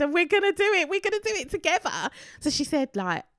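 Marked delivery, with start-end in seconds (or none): 0.0-2.1 high, loud and fast; excited. Retold or imitated. Runner urging her partner to the line, high and excited. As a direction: fire each other up. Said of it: "And she said".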